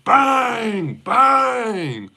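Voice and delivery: very high, cried-out bangs; NBA commentator. Acted. Streamer copying a famous NBA commentator, high shouted bangs. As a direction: shout it like a game-winning basket call. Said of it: "that famous N B A commentator".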